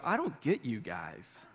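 low, hushed, drawn-out disbelief; outsider scoffing at the church. Retold or imitated. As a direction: sound like a baffled outsider shaking their head. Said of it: "the world looks at the church sometimes and go".

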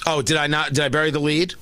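ordinary talk

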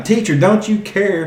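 high pleading cry to the teacher; mock shout. A narrator's reading. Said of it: "the disciples woke him up shouting".

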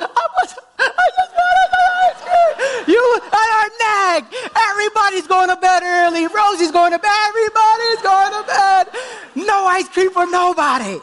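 very high, loud mock sobbing child; whiny crying child. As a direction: imitate a sulking little girl wailing through fake tears. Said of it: "and she's like".

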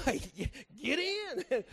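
high, loud, slow "Wait, get in"; loud astonished invitation; excited call. Retold or imitated. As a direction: stop her in amazement. Pastor stops the walking woman.

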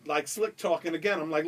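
ordinary delivery

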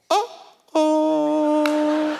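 high, drawn-out uh-oh; Jenga. Retold or imitated. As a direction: imitate a guide's singsong oops. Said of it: "never wanna hear the guide say".

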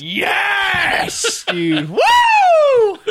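sudden loud whooping cheer; excited shout. Real, not acted. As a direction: yell out in wild excitement. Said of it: "that was the worst jump scare".

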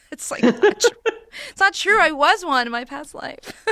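high-pitched protest through laughter; laughing protest. Real, not acted. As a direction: insist it, cracking up. Laughing denial of running joke.

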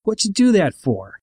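high mock yell at the bully; old man yelling, only lightly voiced. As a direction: demand it, pitched well up. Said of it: "he was yelling at Seymour".

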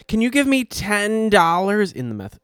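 high, loud put-on street voice; imitating meth girl. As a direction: ape her rough begging voice. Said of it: "They all talk like this".

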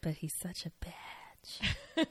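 soft catty whisper; slow conspiratorial whisper; whispered aside. Real, not acted. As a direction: lean in and whisper the dig.

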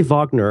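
"Wagner" said as VAHG-ner; V for the W in "Wagner", with a broad ah; German pronunciation. Shown as a demonstration. Co-host giving own preferred way to say the name, "Wagner" German-style as VAHG-ner. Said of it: "I say Wagner".

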